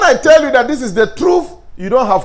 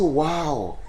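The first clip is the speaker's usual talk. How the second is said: soft flattering compliment; 7 semitones lower, 5 dB quieter than usual. Shown as a demonstration. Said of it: "You say".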